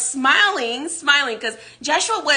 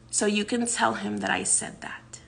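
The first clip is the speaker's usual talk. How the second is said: whisper; 2 semitones lower, 8 dB quieter than usual. Retold or imitated. Joshua ends warning to guard.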